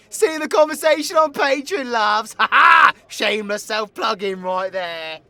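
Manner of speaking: high, loud, laughing outburst; shouted plug. Acted. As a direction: blare it out like a cheeky advert, laughing. Jokey shouted plug for Patreon.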